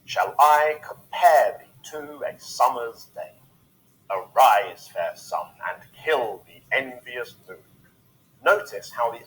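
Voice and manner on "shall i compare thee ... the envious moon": "Shall I compare thee" slowly, loud on I and PARE; slow, emphatic verse, every second syllable of "Shall I compare thee" punched; iambic pentameter. Shown as a demonstration. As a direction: recite "Arise, fair sun" in weighty weak-strong beats, RISE and SUN stressed. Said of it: "used for many of Shakespeare's most famous lines".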